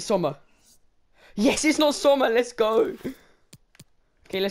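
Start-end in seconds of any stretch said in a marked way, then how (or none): 1.3-3.0 loud, high relieved yell; excited cheer. Real, not acted. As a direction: celebrate dodging him. Relief that a bad player was avoided.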